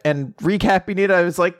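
ordinary talk

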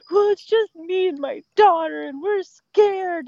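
mock crying caller. Caller tells the dispatcher she and her daughter are scared, mock teary and slow. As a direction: play the scared mother pleading on the phone, a touch teary. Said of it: "just crying out of nowhere".

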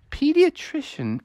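high, questioning repeat; puzzled echo. Acted. Neighbor repeats what mommy said, puzzled. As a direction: echo it high, mulling it over.

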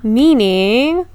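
ordinary talk

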